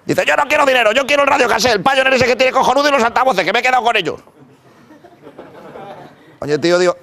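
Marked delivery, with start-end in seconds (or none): 0.3-4.2 much higher silly villain voice; a hammed-up menacing psychopath, pitched way up; psycho robber voice. Red-cape robber demands radio.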